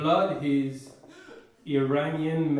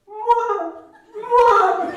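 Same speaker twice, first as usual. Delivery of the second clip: high, loud mock wail with a French accent; French-accented cry; 11 dB louder than usual. Comedian imitating his son's crying, French-accented wail. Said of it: "he cries with a French accent".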